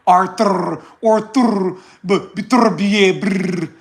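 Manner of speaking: hammed-up Russian accent, stretched slowly on Beterbiev; mock the names in a put-on Russian accent; fake Russian accent. Mocking other channels, drawn-out fake Russian accent. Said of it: "put an accent on and say".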